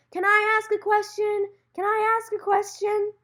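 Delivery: high, loud insistent plea; eager calling out. Retold or imitated. Student calls to the stage to get picked, loud and eager. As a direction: shout it urgently to be picked from a crowd. Retells begging from the crowd.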